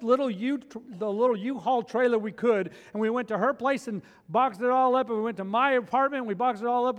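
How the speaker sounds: loud, high, driving preacher voice; fired-up sermon peak; preaching at full force. Acted. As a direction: preach it hard to the congregation. Preaching story told loudly.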